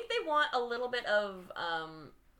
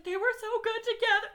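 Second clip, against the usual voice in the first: high-pitched emotional gushing, imitated; mock-emotional, much higher voice; emotional Brittany; 3 dB louder than usual. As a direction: imitate a friend gushing over a couple. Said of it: "who else is emotional? Brittany".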